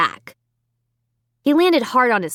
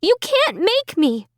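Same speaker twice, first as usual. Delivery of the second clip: much higher scared protest; frightened defiance; 12 semitones higher than usual. A narrator's reading. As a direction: protest through fear. Amy defies dummy.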